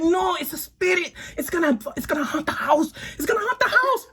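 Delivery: high, fretful neighbor imitation; flipping out. Neighbor warning the house will be haunted, flipping out. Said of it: "she's flipping out".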